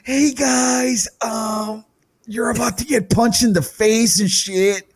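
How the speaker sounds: high, jittery fake-cheerful voice; mock nervous cheer. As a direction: play a nervous friend wishing luck. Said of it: "I'm like".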